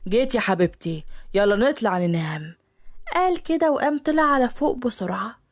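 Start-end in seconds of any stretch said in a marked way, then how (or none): 0.1-2.6 low male voice, grudging then sweet; low husband. Acted. Hadi greets his wife, low and grudging.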